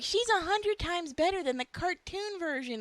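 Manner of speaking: high-pitched mock fan outrage; indignant fan. Fans attacking artists over the cartoon comparison, mock indignant. Mocking angry fans defending the actress.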